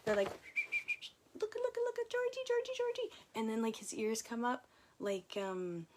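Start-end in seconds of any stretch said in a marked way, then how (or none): 0.3-3.1 very high sing-song calling; calling the dog. Retold or imitated. Voice on the video calling Georgie, high coaxing call. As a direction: coo his name up high like calling a puppy.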